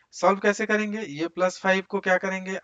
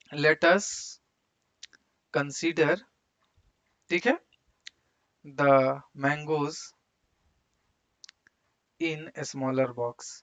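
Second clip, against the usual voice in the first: words parcelled out with long pauses; slow dictation; much slower than usual. Real, not acted. Teacher dictating the problem to the class, slow dictation. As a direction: dictate slowly, phrase by phrase. Dictating while writing on board.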